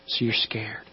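hushed whisper. Acted. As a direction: breathe it out softly. Hushed aside.

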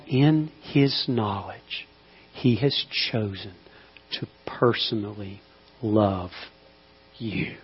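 slow, soft and low; reassuring whisper-soft love; tender. Acted. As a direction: let it settle gently. Tender closing line.

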